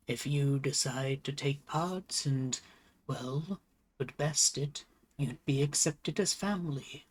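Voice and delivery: lightly voiced wise old turtle, unhurried; old turtle leader. Game master voices elder turtle leader.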